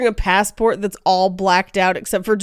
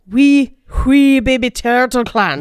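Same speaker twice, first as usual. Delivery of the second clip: slow, higher Scottish with a drawn-out wee; attempted Scottish accent; 4 semitones higher, 4 dB louder than usual. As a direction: lean slowly into the Scottish W's and R's. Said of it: "it's slightly different".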